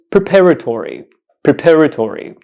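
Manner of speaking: "preparatory" said pruh-PAR-uh-tory, falling; "preparatory" in one smooth run, falling at the end; correct pronunciation. Shown as a demonstration. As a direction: model "preparatory" with a short first syllable and stress on PAR. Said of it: "this word is pronounced".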